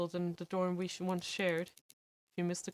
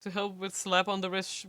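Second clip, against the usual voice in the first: normal talking voice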